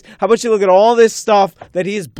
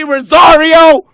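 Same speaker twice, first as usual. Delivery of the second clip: higher and louder, fired-up shout; excited shout; 5 semitones higher, 6 dB louder than usual. Real, not acted. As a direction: shout it like a fan pleading. Shouting about Rosario.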